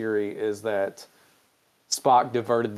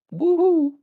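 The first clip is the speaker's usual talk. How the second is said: high, loud sarcastic woo-hoo; mock cheer; 7 dB louder than usual. Real, not acted. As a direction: cheer it like a party horn. Speaker cheers the dinner's end.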